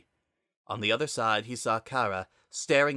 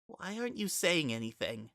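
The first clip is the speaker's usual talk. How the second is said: soft plea; 7 semitones higher, 7 dB quieter than usual. Acted.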